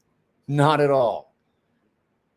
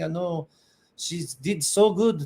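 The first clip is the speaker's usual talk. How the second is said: ordinary delivery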